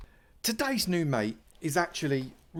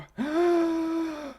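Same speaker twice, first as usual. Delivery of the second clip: loud, high, drawn-out gasp; sudden gasp of alarm; shocked gasp; 6 dB louder than usual. Real, not acted. Streamer startled mid-search for his items, shocked gasp.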